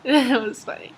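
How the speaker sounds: normal talking voice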